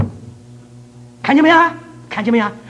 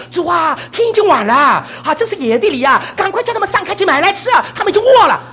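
imploring disciples; 13 semitones higher, 5 dB louder than usual. Retold or imitated. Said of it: "门徒在言语上说". (门徒向主恳求，高声拖长)

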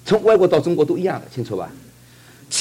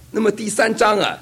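ordinary talk

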